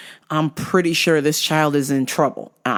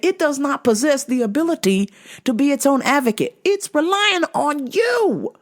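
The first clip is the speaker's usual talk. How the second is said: high-pitched, emphatic lecture; incredulous scolding; 10 semitones higher than usual. Retold or imitated. Store worker lecturing a negligent mother, incredulous scolding.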